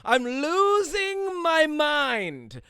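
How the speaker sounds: hammed-up despair, slow and high; mock unhinged wail. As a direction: wail it out like you're coming apart. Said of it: "Losing your mind".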